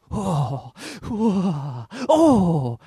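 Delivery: hammed-up trembling oh's; mock quivering oh's. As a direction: imitate the teacher quivering in faces. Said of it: "like, quivering like that".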